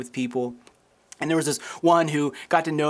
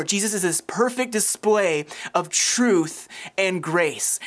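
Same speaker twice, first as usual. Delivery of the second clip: ordinary talk, nothing marked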